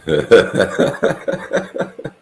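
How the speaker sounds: loud breathy chuckling; laughing. Real, not acted. Reacting to a friend's guess about origins, laughing. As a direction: let out a run of breathy laughs.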